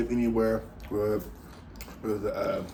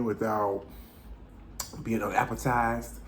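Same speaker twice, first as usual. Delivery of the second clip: ordinary delivery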